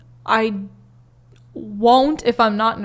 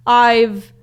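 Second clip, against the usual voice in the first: ordinary talk, nothing marked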